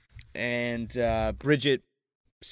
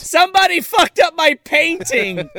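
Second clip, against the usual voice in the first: high, loud hammed-up outcry; put-on aggrieved shriek; mock outrage; 12 semitones higher, 6 dB louder than usual. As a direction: wail it in comic horror. Joke outburst as aging victim.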